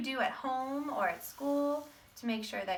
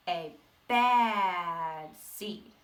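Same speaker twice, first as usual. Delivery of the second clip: very slow, heavy "A bad seed"; drawn-out menace; 3 semitones lower, 7 dB louder, much slower than usual. Teacher reading to kids, dragging out the seed's name slowly. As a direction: stretch every word of "A bad seed" like a villain.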